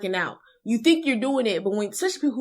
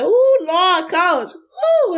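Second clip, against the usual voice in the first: high, loud groaning plea, then a crying whoop; agonized post-sprint whoop; 9 semitones higher, 8 dB louder, much slower than usual. Pretend. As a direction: beg the coach while you're spent. Acting out post-sprint agony.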